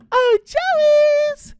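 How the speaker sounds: sweet high voice. Acted. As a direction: fake a sweet mum calling. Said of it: "in his now sweet and high voice".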